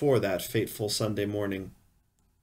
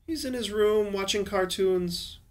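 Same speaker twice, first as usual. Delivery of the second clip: much higher, light woman's voice; voiced wife; 9 semitones higher than usual. A narrator's reading. Wife tells husband about their son, high voice. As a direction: play the wife, pitched well up.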